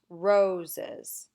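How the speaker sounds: ROSES with weight on RO; Roses, slowly, leaning on the first syllable; stressed first syllable. Shown as a demonstration. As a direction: punch the RO in Roses. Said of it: "the first one is stressed".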